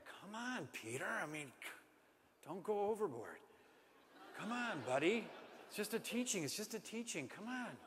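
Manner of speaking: hushed, nervous coaxing; a hint of a worried friend pleading softly; Matthew nervous. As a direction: Matthew quietly begging Peter not to step out, hushed. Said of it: "I imagine Matthew is going".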